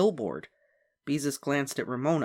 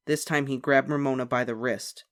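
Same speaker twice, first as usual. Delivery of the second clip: ordinary delivery, nothing marked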